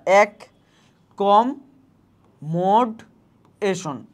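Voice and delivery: এক কম মোড এশন broken into syllables with a pause after each. Shown as a demonstration. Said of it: "এই যে তুমি syllable ভেঙে পড়লা".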